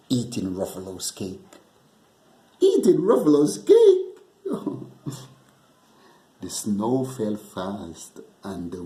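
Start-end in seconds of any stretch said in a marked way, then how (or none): 2.6-4.9 much higher and louder, teasing question that breaks into a laugh; mock the Gruffalo and cackle; sly snake teasing with a laugh.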